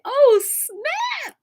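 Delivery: high, loud "Oh, snap"; mock surprise. Retelling her own reaction to the live, high surprised yelp. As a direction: yelp it in playful amazement.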